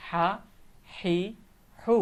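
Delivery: হা, হি, হু each held alone; pronunciation demo. Shown as a demonstration. Teacher answering how ha is pronounced, pronunciation demo. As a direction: sound out হা, হি, হু with gaps. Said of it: "কেমন উচ্চারণ হবে".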